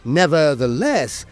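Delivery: ordinary delivery, nothing marked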